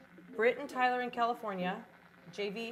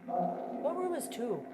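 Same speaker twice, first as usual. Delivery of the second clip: ordinary talk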